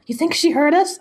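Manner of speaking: high-pitched acted question; anxious mock query. Reader voicing friends caught gossiping, high worried question. Acting two-person exchange from comic.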